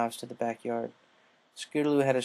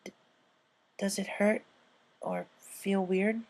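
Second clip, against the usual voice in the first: shaky question; 9 semitones higher, 3 dB quieter, slower than usual. Acted. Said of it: "she shakily asked".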